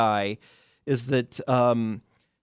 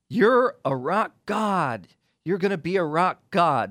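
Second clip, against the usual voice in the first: tempting brother; 4 semitones higher than usual. Retold or imitated. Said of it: "And his brother tells him".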